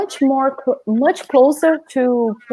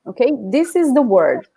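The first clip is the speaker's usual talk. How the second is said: ordinary talk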